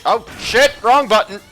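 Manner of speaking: loud high "oh shit"; startled outburst. Real, not acted. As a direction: blurt it out at a mistake. Pressed wrong button.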